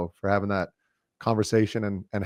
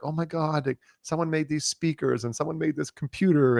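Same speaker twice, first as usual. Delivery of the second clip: over-the-top gratitude; 6 semitones higher than usual. Retold or imitated. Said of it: "so over the top".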